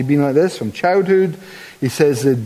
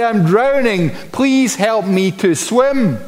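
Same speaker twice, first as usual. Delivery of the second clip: overly polite mock request; 7 semitones higher, slower than usual. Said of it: "A guy who is drowning does not say".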